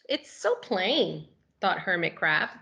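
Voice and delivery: animated picture-book narration; reading to children. A narrator's reading. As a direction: read it like it's story time for little kids.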